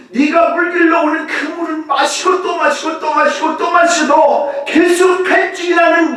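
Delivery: high, loud fervent preaching; preaching shout. Acted. Preacher to congregation, building fervent shout. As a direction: drive the repetitions home.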